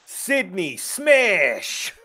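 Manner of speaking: loud, high, long-drawn hero yell; attack cry. Acted. Hero calling out a special attack, drawn-out yell. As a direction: stretch out the move name as a battle cry.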